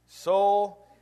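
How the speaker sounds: high, loud call to his own soul; gloating to himself. Acted. Rich man calling to his soul, loud and pleased. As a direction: savor it like a man counting his riches.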